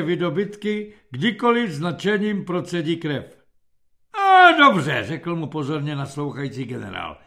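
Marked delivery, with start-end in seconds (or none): 4.1-5.0 high, loud, stretched interjection; general cutting in. A narrator's reading. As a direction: break in loudly on the boasting. General cuts into the captain's boasting. (hlasité generálské přerušení)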